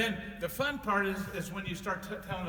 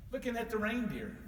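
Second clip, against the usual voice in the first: usual speaking voice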